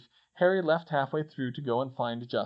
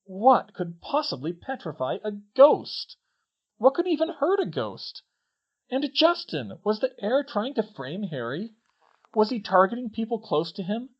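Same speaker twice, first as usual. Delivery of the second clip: high, anxious questions; panicked questioning; 8 semitones higher, 4 dB louder than usual. Acted. Hermione's mind racing over the attacks, panicked. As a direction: let each question climb in alarm.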